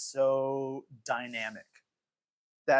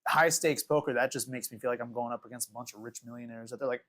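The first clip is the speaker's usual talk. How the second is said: usual speaking voice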